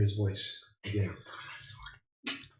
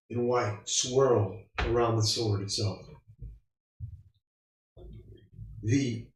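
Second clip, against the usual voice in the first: game master narration; 6 dB quieter than usual. Acted. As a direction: paint the scene softly. GM scene narration.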